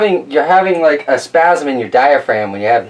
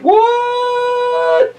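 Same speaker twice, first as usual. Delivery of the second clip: very high, stretched-out "what"; hammed-up squeal of disbelief; astonished crowd. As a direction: draw it out high in shock. Everybody astonished in the story.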